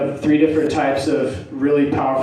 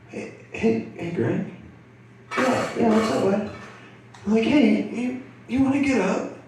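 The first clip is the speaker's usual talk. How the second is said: tentative check-in; 8 semitones higher, 5 dB quieter than usual. Retold or imitated. Tentative check on tripping friend.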